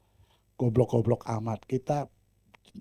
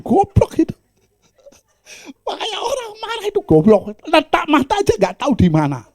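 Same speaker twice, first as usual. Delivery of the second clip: laughing; 7 dB louder than usual. Real, not acted. Laughing at his own story.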